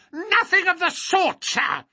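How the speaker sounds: put-on very high, outraged old man's shriek; shrieking, querulous protest; screamed. A narrator's reading. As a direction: cut them off with an indignant screech. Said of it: "screamed the little man".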